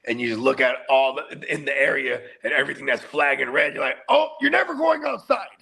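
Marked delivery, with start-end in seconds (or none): 4.1-5.5 high-pitched overprotective exclamation; alarmed father. Retold or imitated. Dad reacting to the online offender map, high alarmed exclamation. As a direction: exclaim it high, laying down the law.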